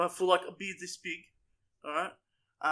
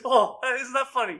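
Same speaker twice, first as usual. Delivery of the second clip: louder, higher, jokey partner voice; cheeky partner; 5 semitones higher, 7 dB louder than usual. Retold or imitated. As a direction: play it off as a joke, louder. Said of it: "He goes".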